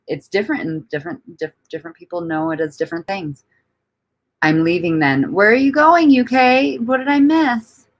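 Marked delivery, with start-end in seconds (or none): 5.3-7.9 high, drawn-up playful cry; mock dismay. Streamer calling after a departing viewer, mock dismay. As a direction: call out in high-pitched mock alarm.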